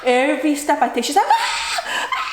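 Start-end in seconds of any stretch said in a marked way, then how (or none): 1.3-2.2 repeated very high mock shrieks; imitated screaming. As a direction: squeal like a hurt toddler, without full force. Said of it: "she's like screaming".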